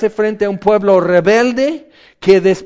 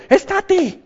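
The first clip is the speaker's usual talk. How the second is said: imitating a child; 13 semitones higher than usual. Voicing restless children.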